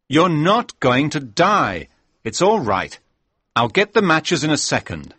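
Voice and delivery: firmly calm a panicking friend; urgent reassurance. Acted.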